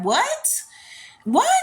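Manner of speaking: high, rising one-word disbelief; incredulous. Real, not acted. Host reacting to a commenter's boyfriend, incredulous. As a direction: blurt it as a stunned high question.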